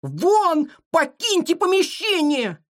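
high, drawn-out angry cry; furious order. A narrator's reading. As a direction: order intruders out in a high temper. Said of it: "сверкали от гнева". (гневный крик высоким голосом)

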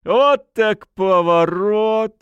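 high, loud, drawn-out astonishment; tipsy amazed cry; surprised exclamation. A narrator's reading. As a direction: exclaim it slowly, high-pitched. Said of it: "воскликнул парень".

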